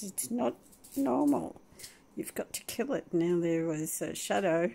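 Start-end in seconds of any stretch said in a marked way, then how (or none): 0.0-3.1 lightly voiced dog, higher; voicing the dog. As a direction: speak for the dog, a bit higher. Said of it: "He says".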